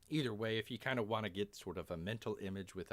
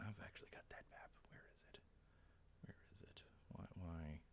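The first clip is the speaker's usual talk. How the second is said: very soft, whispered muttering; barely audible whisper to yourself; muttering to oneself; 3 semitones lower, 13 dB quieter than usual. Real, not acted. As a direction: mumble under your breath while searching. Muttering while searching for map.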